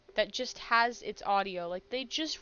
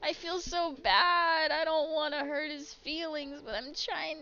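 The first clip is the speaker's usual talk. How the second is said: guilty whine; 6 semitones higher than usual. Real, not acted. Guilty about neglecting pet.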